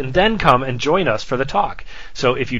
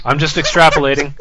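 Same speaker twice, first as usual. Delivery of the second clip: usual speaking voice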